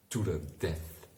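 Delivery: plain reading voice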